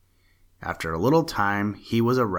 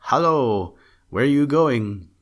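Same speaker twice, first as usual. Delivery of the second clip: louder, higher call to the man below; 5 semitones higher, 6 dB louder than usual. A narrator's reading. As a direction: hail him from the air. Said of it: "calling out".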